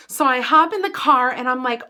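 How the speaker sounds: usual speaking voice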